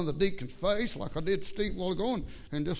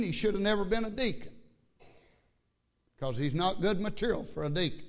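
ordinary delivery

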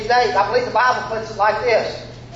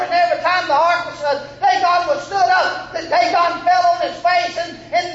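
fervent preaching; 5 semitones higher than usual. Real, not acted. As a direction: thunder it at the idol worshippers. Preacher at full force.

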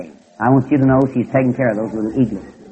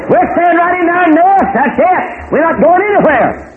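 put-on stubborn baby eaglet. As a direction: squeal it like a stubborn chick refusing to budge. Eaglet refuses to leave nest.

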